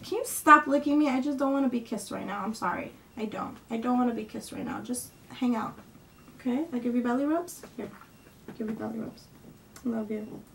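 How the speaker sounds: softly soothing her dog; gently to the dog. Real, not acted. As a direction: ease the dog off with quiet, kind words. Talking to licking dog.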